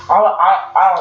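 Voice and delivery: real stutter on the first word. Real, not acted. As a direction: catch and repeat the opening word. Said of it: "I'm stuttering again".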